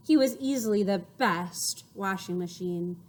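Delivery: ordinary delivery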